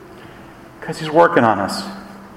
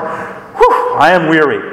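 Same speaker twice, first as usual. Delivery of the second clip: weary sigh; 2 semitones higher than usual. Pretend. Mock tired churchgoer.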